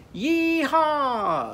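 high, loud cowboy whoop; yee-haw cowboy call. Shown as a demonstration. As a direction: holler it like a cowboy on a bronco. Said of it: "a cowboy goes".

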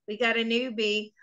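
ordinary talk, nothing marked

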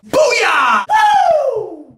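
high, loud cheer into a whoop; excited shout. Real, not acted. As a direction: yell it triumphantly, then whoop. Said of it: "I've gotten excited during a video".

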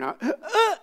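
high choked gasp then "Uh" squeaked up; hammed-up choked-back cry; almost crying. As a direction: mimic a sob catching in the throat. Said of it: "made a sound ... almost crying".

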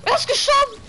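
high, loud hype announcer; wrestling commentator. Pretend. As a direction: call the fail like a ringside announcer. Announcing enemy's fail, hyped.

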